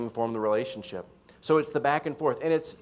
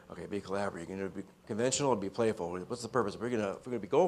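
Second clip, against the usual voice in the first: soft, low inner monologue; thinking aloud; 5 semitones lower, 7 dB quieter, faster than usual. Retold or imitated. Mentor silently weighing what to do, quiet thinking aloud. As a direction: run through options quietly, as if to yourself. Voices own inner deliberation.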